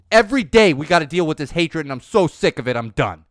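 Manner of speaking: loud, heated rant; fed-up, loud outrage; furious rant. Real, not acted. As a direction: vent real fury at the hatred.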